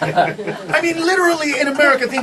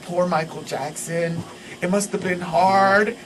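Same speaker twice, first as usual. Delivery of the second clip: low, soft, slow fake sympathy; hammed-up sorrowful pity; mock pity; 7 semitones lower, 4 dB quieter than usual. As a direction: imitate mournful sympathy, drawn out. Mocking people who pity the star.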